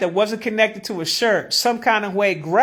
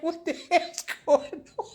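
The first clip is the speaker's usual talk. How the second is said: laughing quote; 4 semitones higher, 8 dB quieter than usual. Retold or imitated. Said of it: "'Cause I'm like".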